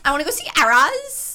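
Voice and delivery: put-on excited, fast girl-in-a-bar voice; imitating a Swiftie. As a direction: gush like a fan about the tour. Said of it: "She, she's been".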